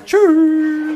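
high, loud drawn-out farewell; loud goodbye. Real, not acted. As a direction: sing out a big goodbye. Loud sing-song podcast goodbye.

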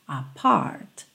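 "Apart" with the final T released, low and soft; T sounded. Shown as a demonstration. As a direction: land on the T of "Apart". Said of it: "poderia ser também apart".

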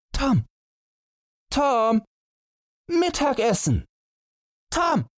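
high calling voices across the garden. Acted. Family calling the hidden boy to lunch, high sung-out calls. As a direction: sing out the name high and long. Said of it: "riefen sie".

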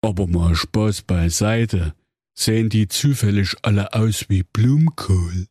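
Grimm brother as a call-centre recording; a polite hotline greeting from Jakob Grimm. Acted. Grimm asks. (Jakob Grimm als Hotline-Ansage)

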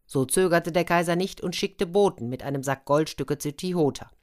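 ordinary delivery, nothing marked

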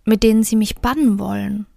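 gentle storyteller reading a Märchen; fairy tale narration. A narrator's reading. Reads a fairy tale aloud. (ruhig vorgelesenes Märchen)

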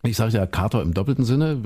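ordinary delivery

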